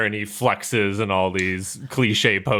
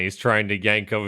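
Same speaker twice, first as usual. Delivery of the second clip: usual speaking voice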